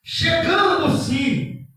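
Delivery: loud, slow, sermon-style scripture reading; slow, loud preacher's Bible reading over music; preaching scripture. Acted. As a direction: proclaim the story to the congregation.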